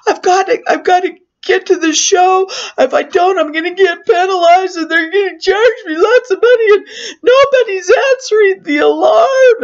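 sobbing out every worry in one rush; crying panic. Retold or imitated. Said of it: "I start crying".

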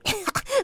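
high short chuckle. Acted. Grandfather chuckling while boasting. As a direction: chuckle mid-sentence. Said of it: "Der Großvater lachte".

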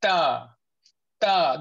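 단단하고 또렷한 ta; crisp, solid ta from the gum roots; correct ta. Shown as a demonstration. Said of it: "this is ta".

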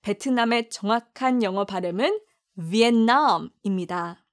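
normal talking voice

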